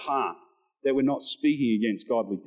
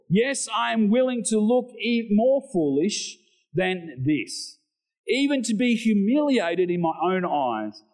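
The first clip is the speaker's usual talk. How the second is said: raised, measured Bible reading; scripture reading; 6 semitones higher than usual. Acted. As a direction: read the passage out to the church with weight. Reads scripture aloud.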